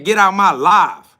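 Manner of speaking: yelling a viewer out of the stream; loud dismissal. Real, not acted. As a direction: kick them out with a shout. Kicking a viewer out.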